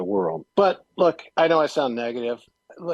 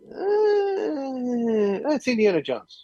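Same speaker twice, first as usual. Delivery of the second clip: shrugging indifference; 12 semitones higher than usual. Retold or imitated. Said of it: "I was like".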